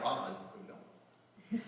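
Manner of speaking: deflated, slow and soft; limp denial. Shown as a demonstration. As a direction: say you're fine without meaning it. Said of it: "내 몸이 착각한다니까요".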